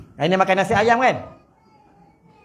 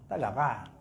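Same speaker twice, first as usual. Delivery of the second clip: mumbled; 6 semitones lower, 11 dB quieter, slower than usual. Pretend. Preacher mutters to husbands that their wives are not fierce, a sly mumble. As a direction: mumble Tak garang low and soft, under the breath.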